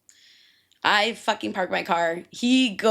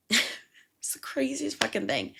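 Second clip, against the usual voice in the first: laughing whisper; 4 semitones higher, 5 dB quieter, slower than usual. Real, not acted. Disbelief while telling the story.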